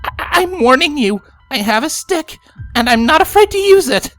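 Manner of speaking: high, loud, stammering threat; scared warning. A narrator's reading. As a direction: bluff bravely while frightened. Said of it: "Lyra began shaking".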